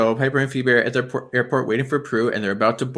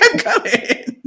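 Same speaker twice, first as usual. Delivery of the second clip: high, slow, drawn-out call; stretched-out call; 5 dB louder, much slower than usual. Retold or imitated. Prue hurrying toward her sisters, a high, slow, drawn-out call. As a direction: drag it out like calling across a hall.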